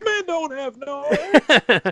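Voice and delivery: high, drawn-out mock objection; imitated kid protesting; doubtful movie kid. Imitating the kid's famous line.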